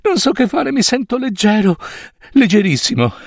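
high-pitched, overwhelmed Scrooge beside himself with joy; light-headed and bubbling over, high voice; giddy elation. Acted. Scrooge esulta, stordito dalla gioia. (euforico, stordito dalla gioia)

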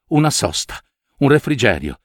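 ordinary delivery

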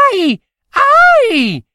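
sharp high yelps of pain; little satyr yelping in pain; pained ouch cries. Acted. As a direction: yelp as the arrow strikes.

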